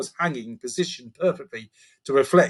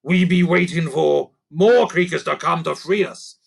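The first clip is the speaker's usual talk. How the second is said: gruff goblin voice; louder creaker; 4 semitones higher, 4 dB louder than usual. Acted. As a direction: boast for Lucy to hear. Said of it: "More loudly".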